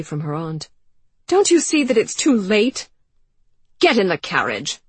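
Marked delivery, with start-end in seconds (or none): 1.3-2.9 high, loud anguished protest; desperate protest. Acted. 3.8-4.8 loud, level command; curt order. Acted. Mother orders curtly.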